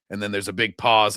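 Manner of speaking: ordinary talk, nothing marked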